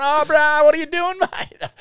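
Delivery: high, loud disbelieving call ending in a laugh; Nicky exclaiming. Retold or imitated. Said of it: "He's going".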